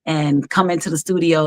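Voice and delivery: ordinary delivery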